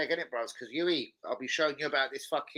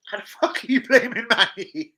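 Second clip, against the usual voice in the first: 1 incredulous outburst; 9 semitones higher, 6 dB louder than usual. Real, not acted.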